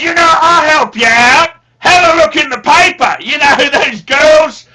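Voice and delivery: loud, high shouting father with a laugh breaking in; dad shouting. Retold or imitated. Son imitates father offering help, loud shouting. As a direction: bellow the advice, half laughing. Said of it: "he goes".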